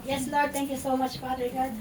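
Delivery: closing prayer. Real, not acted. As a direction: pray with rising fervour. (taimtim at malakas na panalangin)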